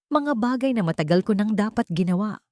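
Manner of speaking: ordinary delivery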